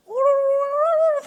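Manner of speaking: high rolling bird trill; oriole trill. Retold or imitated. Heavenly tongue like an oriole, high trill. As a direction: roll it like a songbird. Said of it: "꾀꼬리 굴러가는 것처럼".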